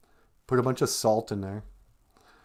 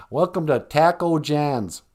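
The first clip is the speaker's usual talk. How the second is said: slow, flattened Minnesotan vowels in "Taco John's"; Minnesota accent; 3 semitones higher, 4 dB louder than usual. Acted. Welcoming customers to the taco place, Minnesota accent. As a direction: greet them in a broad, slow Minnesota accent. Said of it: "like, Minnesota accent".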